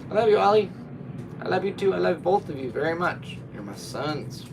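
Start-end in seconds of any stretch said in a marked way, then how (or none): 0.1-3.4 high, loud cooing to the cat; sweet high pet talk. Real, not acted. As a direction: coo it to your pet. Cooing to the dog.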